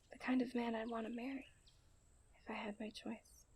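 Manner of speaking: soft and hushed, telling you about the man she loves; quiet heartfelt confession; tender confession. Acted. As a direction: let it fall to a near whisper, full of longing. Confessing her love for him.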